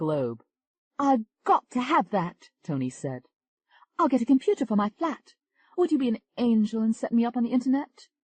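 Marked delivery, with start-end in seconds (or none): 1.0-2.5 higher, slow and longing; higher awed English woman; Tony, amazed. Acted. Tony amazed by chat rooms. 4.0-8.1 higher, eager English woman; higher English lady asking a favour; Tony's voice. Acted. Tony asks for setup.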